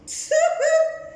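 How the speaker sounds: short high-pitched giggle; high laugh. Real, not acted. Storyteller amused mid-story, high laugh. Loud high sound between lines.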